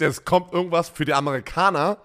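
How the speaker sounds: ordinary talk, nothing marked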